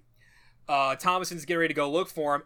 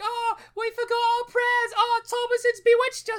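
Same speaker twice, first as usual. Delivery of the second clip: very high shrill wailing; hammy possessed child; 4 dB louder than usual. Retold or imitated. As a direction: overact terror of the devil. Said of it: "both twins start acting like".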